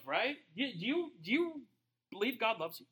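ordinary delivery

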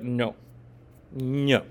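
put-on pouty little kid. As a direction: sulk like a kid refusing to pray. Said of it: "Like a little kid".

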